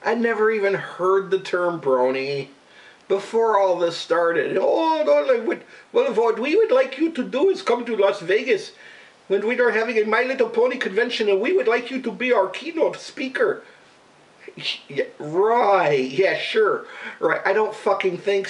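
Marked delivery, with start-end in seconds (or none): 4.6-13.6 higher put-on Indian accent of the prank caller; mock caller accent. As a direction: imitate the caller's fake Apu-style accent. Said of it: "That's kinda what he sounded like".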